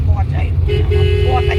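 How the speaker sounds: put-on breathy whisper to the baby; whispered baby talk. Shown as a demonstration. Whispering the pet name to the baby. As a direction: whisper it playfully.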